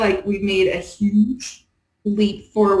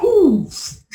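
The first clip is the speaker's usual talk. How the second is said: excited; 10 semitones higher, 3 dB louder than usual. Real, not acted. Delighted by a word.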